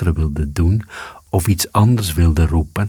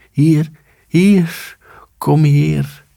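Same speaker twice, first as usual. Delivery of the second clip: much higher tiny insect voice; high firefly call; 10 semitones higher, much slower than usual. A narrator's reading. The dog's howl heard as the fireflies' call, high. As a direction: echo the fireflies' little call. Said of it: "hetzelfde als de vuurvliegjes riep".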